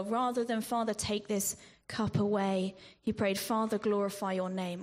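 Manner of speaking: ordinary delivery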